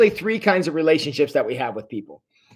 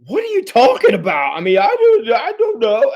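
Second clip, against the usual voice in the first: higher, baffled protest; confused protest; 8 semitones higher than usual. Retold or imitated. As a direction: throw up your hands, lost and defensive. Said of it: "so when Jason was like".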